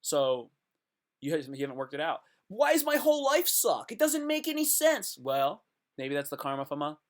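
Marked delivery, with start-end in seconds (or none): 2.5-5.2 high, loud mock complaint; mock whining. Griping to the universe about a bad life, mock whining. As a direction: whine it way up high like a hammed-up tantrum.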